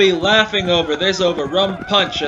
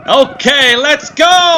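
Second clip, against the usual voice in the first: a high, loud battle cry; rallying shout; 8 semitones higher, 5 dB louder, slower than usual. Acted. Dan setting off to draw the raiders' fire, rallying shout. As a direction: launch yourself with a yell.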